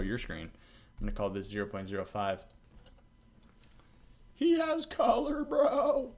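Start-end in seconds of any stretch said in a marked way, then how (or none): 4.3-6.1 much higher, slow, thrilled "he has color"; high, drawn-out excited exclaim; delighted squeal. Real, not acted. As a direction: gush with real delight. Delighted at the rendered model.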